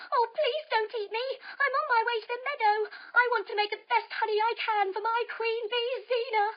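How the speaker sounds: high, light pleading voice; a small bee politely begging the spider not to eat him. Acted.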